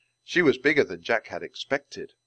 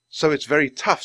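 excited; 6 semitones higher, 7 dB louder than usual. A narrator's reading. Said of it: "growing excited".

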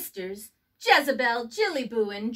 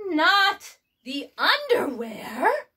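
higher, slow, shocked voice; shocked exclaim; 6 semitones higher, 5 dB louder, slower than usual. A narrator's reading. Skipito aghast at the underwear, high and slow. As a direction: gasp out the horror. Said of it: "exclaimed Skipito".

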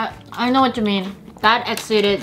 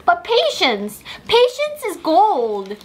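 teasing; 9 semitones higher, slower than usual. Acted. Teasing hungry friend to wait.

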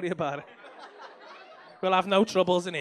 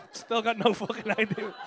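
usual speaking voice

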